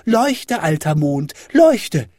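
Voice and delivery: put-on high child's clamouring; high-pitched, insistent yelling; demanding shout. A narrator's reading. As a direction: cry out orders like an impatient little boy. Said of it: "schrie Häwelmann".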